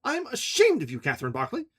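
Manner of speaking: high, tight scolding woman's voice; Ferguson, scolding. A narrator's reading. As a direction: voice Fergie as a sharp, reproachful friend.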